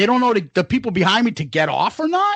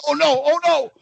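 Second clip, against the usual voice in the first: higher-pitched dread, retold; mock panic; 7 semitones higher than usual. Wheelchair tips and sets off nausea, mock panic. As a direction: act out rising panic before being sick.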